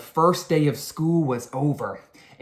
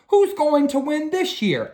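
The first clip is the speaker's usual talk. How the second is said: high, louder rallying question; principal asking; 12 semitones higher, 4 dB louder than usual. A narrator's reading. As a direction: rouse the crowd. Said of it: "asked the principal".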